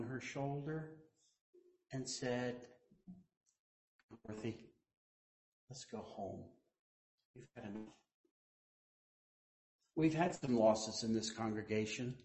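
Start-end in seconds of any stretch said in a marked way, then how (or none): 4.3-7.9 soft and low, gentle calling; Jesus gently calling her home, soft and low; tender. Retold or imitated. Imagined Jesus speaking gently.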